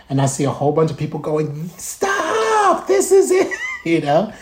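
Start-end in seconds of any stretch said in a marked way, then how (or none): 1.8-3.5 high, stretched-out mock yell; mock crowd shouting. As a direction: imitate people hollering to a passer-by. Crowd calling out to him.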